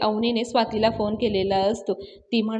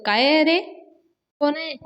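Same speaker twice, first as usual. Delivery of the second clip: shouting; 5 semitones higher than usual. Acted. Datta shouting at stone thrower.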